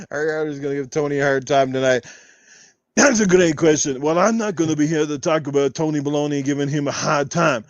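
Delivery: full podcast rant in a held Arnold voice; Austrian-accented action-star voice, kept up line after line; Arnold Schwarzenegger impression. Podcast host answering viewers in character as Arnold, Austrian action-star voice. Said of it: "enough Arnold questions".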